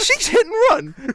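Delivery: laughing disbelief. Real, not acted. Retelling the confession, laughing.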